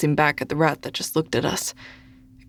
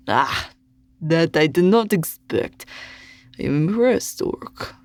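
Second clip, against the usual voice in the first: put-on sneering Russian accent. A narrator's reading. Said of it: "the way he said orc".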